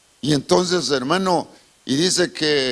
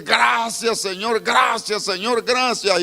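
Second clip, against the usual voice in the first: fervent thanks; 7 semitones higher, slower than usual. Retold or imitated. Pastor crying out thanks to the Lord, fervent.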